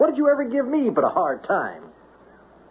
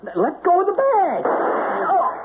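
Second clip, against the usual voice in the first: panicked cry; 12 semitones higher than usual. Acted. Mailman struggling before the shot.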